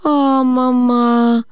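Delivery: long, high, drawn-out groan of words; exasperated protest. A narrator's reading. Lise protests at mama's silly worry, exasperated. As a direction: stretch it out in high-pitched exasperation.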